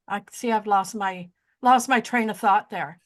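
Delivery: ordinary talk, nothing marked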